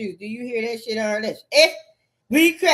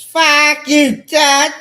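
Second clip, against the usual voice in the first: loud, higher angry shout; yelled curse; 6 semitones higher, 13 dB louder, much slower than usual. Real, not acted. Ranting at Todd, a loud furious yell. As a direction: bark it out at full volume.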